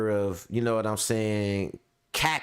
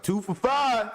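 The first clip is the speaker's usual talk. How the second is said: high sing-song hustler pitch; street hustler call; 11 semitones higher than usual. Retold or imitated. Imagined street seller hawking to passersby, high call. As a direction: call out the deal like a corner seller.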